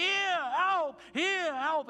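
loud, high desperate cry; shouting for help. Retold or imitated. Preacher acting the crowd crying to Jesus, shouting. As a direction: scream it like the crowd. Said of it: "De mensen bij Jezus schreeuwden".

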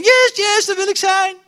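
very high, loud thrilled yes; thrilled cheer. Retold or imitated. Young footballer answering the crowd, very high and thrilled. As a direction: jump at the honour.

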